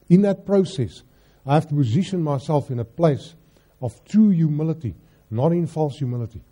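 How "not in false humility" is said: ordinary delivery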